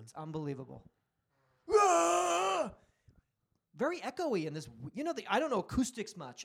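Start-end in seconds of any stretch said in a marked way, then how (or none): 1.7-2.7 high, loud imitated scream; mock scream. Imitating fans screaming in the bathroom, mock scream. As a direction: drunk fan's bellow in an echoing bathroom. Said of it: "screaming at the top of your fucking lungs".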